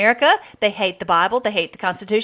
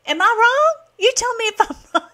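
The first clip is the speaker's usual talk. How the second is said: indignant high challenge; 14 semitones higher, 4 dB louder than usual. Real, not acted.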